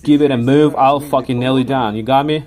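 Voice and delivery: half-strength shouted warning; retold screamed threat. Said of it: "I was screaming to this lad".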